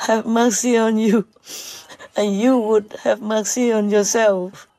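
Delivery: usual speaking voice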